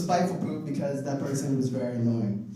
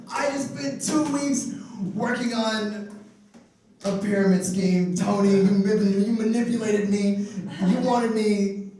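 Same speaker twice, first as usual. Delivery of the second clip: higher, hammed-up indignant rant with laughter; mock outraged rant; 6 semitones higher than usual. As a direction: play the betrayed victim calling out the scammer. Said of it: "And I was just like".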